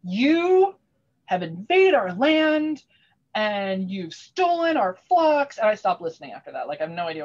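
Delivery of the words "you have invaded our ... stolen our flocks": higher, louder, slow proclamation; pompous baron's challenge. Retold or imitated. Baron challenging a rival barony at court, grand slow proclamation. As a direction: declare it grandly and slowly, like a herald. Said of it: "turns to Fish and is like".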